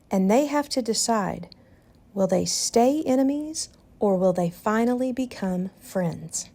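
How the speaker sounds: plain reading voice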